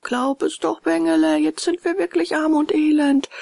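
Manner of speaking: high, pleading beggar's wail; pitiful howling plea; wailing fox. A narrator's reading. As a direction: whine for pity, pitched high. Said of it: "So heulten die zwei Räuber".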